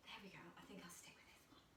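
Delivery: whispered under her breath; breathy aside to herself. Real, not acted. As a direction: drop to an unvoiced whisper while balancing. Teacher balancing, whispering.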